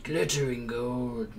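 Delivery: slow, drawn-out mock narrator; muttered narrator imitation. Player muttering a favorite game line to himself, slow narrator imitation. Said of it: "I find myself muttering every now and then".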